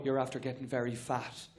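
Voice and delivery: low, soft deadpan mammy; a casual insult from a low-voiced mum; mother at the dinner table, low and soft; Irish mother voice. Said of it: "she'll go".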